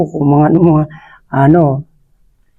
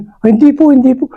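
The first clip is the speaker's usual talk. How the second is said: higher, earnest denial as the frightened brothers; brothers' hurried higher plea of innocence; pleading brothers; 8 semitones higher than usual. Retold or imitated. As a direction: beg him to believe you, pitched up.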